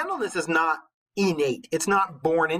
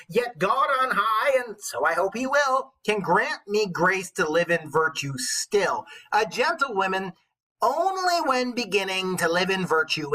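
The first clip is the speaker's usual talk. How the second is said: put-on higher, slowed old witch reading verse aloud; storybook witch voice declaiming Chaucer; 4 semitones higher than usual. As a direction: recite it like a wise old witch. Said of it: "go back into my witch voice".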